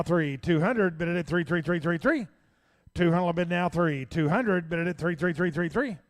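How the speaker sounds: fast rhythmic auction chant; auctioneer. Acted. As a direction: call the bids like an auctioneer. Auctioneer bid calling.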